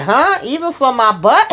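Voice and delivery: high, loud incredulous squeak; the boy can't believe what he's hearing; shocked disbelief. Retold or imitated. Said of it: "He was like".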